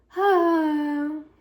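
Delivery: loud, weary sigh; long exhausted sigh; heavy sigh. Real, not acted. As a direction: let out a big tired exhale. Tired, wants to sleep.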